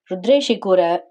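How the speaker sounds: plain reading voice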